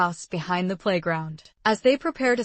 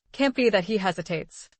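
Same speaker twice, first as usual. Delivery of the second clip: usual speaking voice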